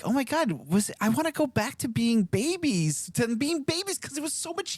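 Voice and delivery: put-on high, whining wish for the baby days; pining for the baby years; whiny mock lament. Parent mocking his own complaint, high and whiny. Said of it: "I'm like".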